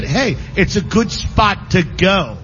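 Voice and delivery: ordinary delivery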